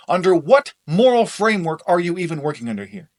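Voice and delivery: loud, heated; angry shouting. Acted. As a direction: hammer each point in anger.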